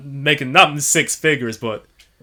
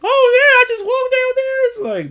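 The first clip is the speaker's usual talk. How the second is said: high-pitched, drawn-out imitation; mock carefree reply; 4 dB louder, slower than usual. Imitating someone who just walked down there, mock carefree. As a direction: put it high and stretch it out slowly.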